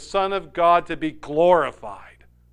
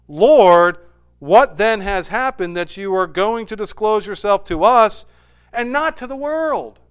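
wondering plea; 5 semitones higher, 8 dB louder than usual. Acted. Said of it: "he says to Jesus".

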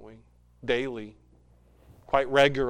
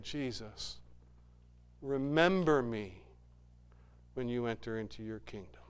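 softer, a quiet humble request; humble plea; 3 semitones lower, 6 dB quieter than usual. Acted. Repentant thief pleading with Jesus, hushed. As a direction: ask it low, like a dying man.

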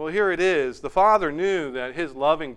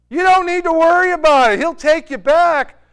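insistent reassurance; 12 semitones higher, 10 dB louder than usual. Retold or imitated. Reassuring brother, retold.

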